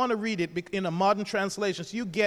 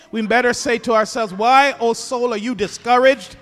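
fervent preaching; 7 dB louder than usual. Acted. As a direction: lift the congregation with it. Sermon climax.

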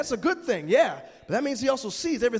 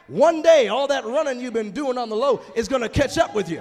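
fiery preaching; 3 semitones higher, 6 dB louder than usual. Real, not acted. Preacher shouting a warning to the congregation.

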